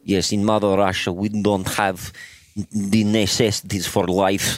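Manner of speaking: lightly voiced mock Russian accent; a hint of Russian, slow in places. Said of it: "the Russian accent is you swallow words".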